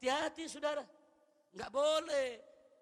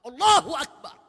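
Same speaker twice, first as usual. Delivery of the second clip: loud, fervent takbir; full of spirit; 3 semitones higher, 20 dB louder than usual. Shown as a demonstration. Preacher demonstrates a proper takbir to congregation, spirited and loud. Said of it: "Penuh semangat".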